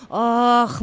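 plain reading voice

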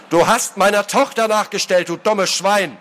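high-pitched angry outburst; furious accusation. Acted. Father accusing his daughter's pursuer, furious. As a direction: hurl the insult at him.

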